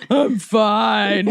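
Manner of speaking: a touch of grumpy, mock-yelled brush-off; grumpy teen reply. Sleepy son snapping at dad, mock grumpy. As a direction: toss it back, half-hearted and grumpy.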